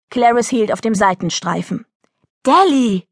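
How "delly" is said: raised, higher-pitched scolding call; angry bellow. Acted. Mother yelling at her runaway daughter, angry bellow. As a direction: call the child sharply, mildly shouted. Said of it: "brüllte sie".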